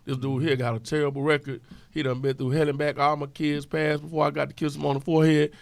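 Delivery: usual speaking voice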